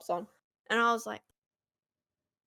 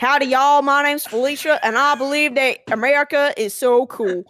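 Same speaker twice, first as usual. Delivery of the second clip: loud, drawling Southern American caricature; hammed-up American; 3 dB louder than usual. Performing American on request for a classmate, hammed-up American accent. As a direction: gush in an over-the-top American twang. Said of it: "can you speak American too?".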